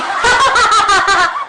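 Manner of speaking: loud, fake cackle imitating the women; hammed-up mocking laughter. Aunt imitates the friends laughing, loud mock laugh.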